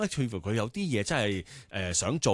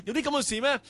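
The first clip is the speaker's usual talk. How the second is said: sharply higher, astonished question; startled disbelief; 11 semitones higher, faster than usual. Retold or imitated. Father hearing about his daughter's talent, startled. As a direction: ask it as if you can't believe it. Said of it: "我嚇親喎".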